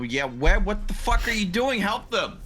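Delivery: normal talking voice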